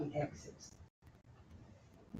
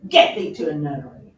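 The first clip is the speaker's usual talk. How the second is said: harshest tone; 5 semitones higher, 14 dB louder than usual. Acted. Hamlet turning on Ophelia, harsh and loud. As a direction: spit it at her in cold rage. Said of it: "in the harshest tone imaginable".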